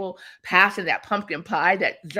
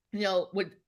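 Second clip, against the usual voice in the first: usual speaking voice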